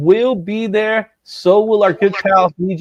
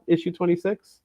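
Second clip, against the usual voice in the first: ordinary talk, nothing marked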